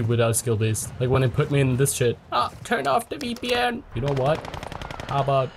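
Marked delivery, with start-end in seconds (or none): 2.3-3.8 high whiny taunt; mock squeaky complaint. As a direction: squeak it like a brat. Mocking a laggy player.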